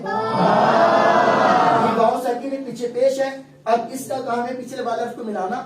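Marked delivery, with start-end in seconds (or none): 0.0-2.6 واو stretched long and sung out, louder; drawn-out vowel. Shown as a demonstration. Teacher chanting the long واو to the class. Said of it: "پڑھیں".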